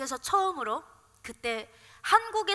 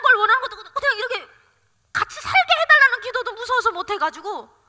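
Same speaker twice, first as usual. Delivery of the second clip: raised, loud voice thick with tears; tearful pleading; 5 semitones higher, 9 dB louder than usual. Real, not acted. Mother too scared to ask for life with child, tearful protest. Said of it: "눈물이 나는 거예요".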